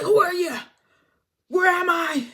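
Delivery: high, loud startled questions; confused waking panic. Retold or imitated. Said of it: "she's very confused".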